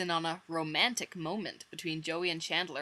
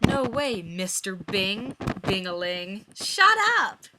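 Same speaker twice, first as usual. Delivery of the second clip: teasing Joey; 7 dB louder, slower than usual. Acted. Joey teasing his friend, squealing at the end. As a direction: tease him, then squeal shut up.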